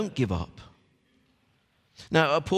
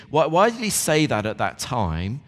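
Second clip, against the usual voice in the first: ordinary talk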